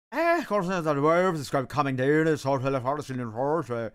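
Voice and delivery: loud, thick rural Irish accent imitation; old Irish farmer. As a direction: mimic an old Irishman's near-unintelligible brogue, loudly. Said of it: "this one old man in Ireland".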